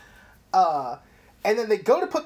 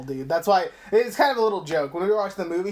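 ordinary delivery

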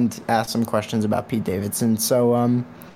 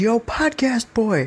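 much higher, slow mocking call of a huge stranger; imitating a big guy; 10 semitones higher, slower than usual. Huge guy calling after the podcaster, hammed-up high voice. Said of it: "and he goes".

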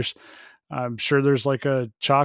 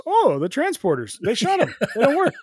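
Scotty impression; 9 semitones higher than usual. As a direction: panicky high-pitched Scotty. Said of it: "Scotty goes".

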